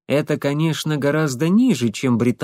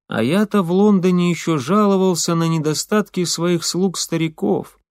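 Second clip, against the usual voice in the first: ordinary delivery, nothing marked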